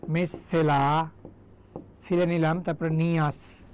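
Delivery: মিসসেলা very slow, last "la" held long; syllable-by-syllable dictation. Shown as a demonstration. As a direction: stretch মিসসেলা out for spelling. Teacher breaks word for spelling. (মিসসেলা টেনে টেনে ভেঙে উচ্চারণ)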